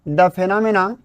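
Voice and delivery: very slow, separated words for note-taking; word-by-word dictation. Acted. Teacher dictating the English definition, very slow and spaced. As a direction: dictate each word with long gaps.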